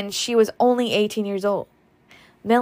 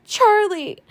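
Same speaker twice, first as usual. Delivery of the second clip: high sobbing wail of a name; a sudden wail, high and loud; sobbing cry; 13 semitones higher, 6 dB louder than usual. Acted. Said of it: "sobbed Pitty Pat".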